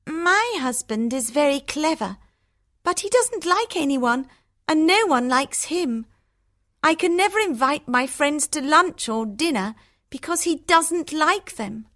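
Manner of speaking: Hortensia. Acted. Hortensia voiced.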